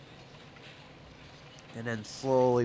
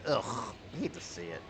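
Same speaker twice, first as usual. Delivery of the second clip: ordinary talk, nothing marked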